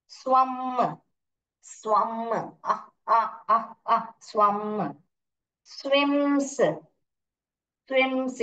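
"स्वम" with a long held m, isolated; phonics sounding-out. Shown as a demonstration. Teacher modelling verb forms, phonics sounding-out. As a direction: pick out "आ" in short repeats, then "स्वम".

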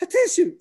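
loud, high mock sneeze; imitated sneeze. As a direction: burst out a pretend achoo. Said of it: "sneezing all the time".

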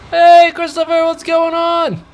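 loud, high-pitched playful holler; calling out. Pretend. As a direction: hail your employee across the road, drawn out. Calls out to his employee.

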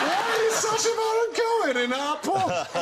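high-pitched imitated pub bloke yell; shrieky comic incredulity; incredulous outburst. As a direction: cry it out in mock disbelief. Bloke cries out in disbelief.

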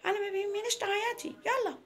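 high-pitched, insistent order; stern father. Acted. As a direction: send the daughter off, voice pitched right up. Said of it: "قال لها". (أب بيأمر بنته بحزم)

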